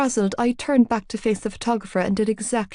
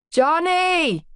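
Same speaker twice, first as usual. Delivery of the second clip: booming call; 10 semitones higher than usual. A narrator's reading. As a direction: call him from afar. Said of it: "A booming male voice called out".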